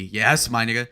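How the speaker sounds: ordinary talk, nothing marked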